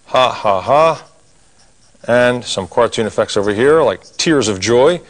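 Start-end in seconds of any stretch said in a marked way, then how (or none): 0.1-1.1 mock ha-ha laughter, spelled out; demo laugh. Shown as a demonstration. Teacher acting out the laughing cartoon face, mock ha-ha laugh. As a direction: act out the drawing's laugh, lightly. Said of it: "And now it's ha-ha time".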